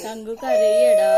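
high, drawn-out fake wail, half laughing; mock crying. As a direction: pretend to cry with a laugh breaking through, slow and high. Mother acts out Kanku crying. (കളിയായി കരയുന്നപോലെ, ചിരിയോടെ നീട്ടി)

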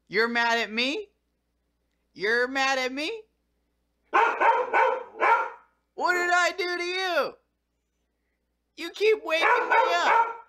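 high sing-song complaint to the dog; talking to the dog. Real, not acted. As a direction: whine at the dog in a high pet voice.

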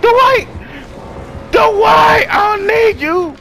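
high, drawn-out holler for help; desperate screaming. Real, not acted. Player screams for Dwight to save them, desperate high shout. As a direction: scream the name, stretching every word.

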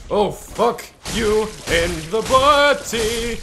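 drawn-out, goofy taunt at the enemy; a silly drawn-out jeer; slow mock taunt. Real, not acted. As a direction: stretch the insult out slowly, teasing. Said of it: "I say some weird shit".

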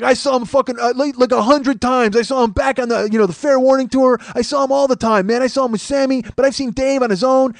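high, loud defensive fan; retold worked-up fan insisting; heated bragging. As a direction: boast back loudly mid-argument. Fan boasts in street argument.

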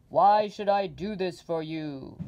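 loud, booming giant head; demanding Oz. Acted. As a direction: boom out the demand. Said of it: "the voice of Oz interrupted".

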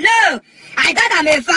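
ordinary talk, nothing marked

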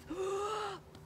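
high, voiced sucking gasp; big theatrical inhale; huge breath in. A narrator's reading. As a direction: inhale audibly like a dragon. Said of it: "took another huge breath".

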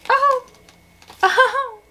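short high-pitched squeals of delight; overwhelmed squeal. Real, not acted. Book fan seeing a beloved character's card, overwhelmed squeal.

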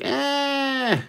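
high yelp. Retold or imitated. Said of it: "He's like".

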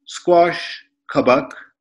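ordinary delivery

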